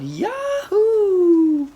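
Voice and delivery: high, loud yahoo cheer. A narrator's reading. Squirrel cheering the sloth's kung fu, loud and high. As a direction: cheer with all your lungs. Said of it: "cheered aloud".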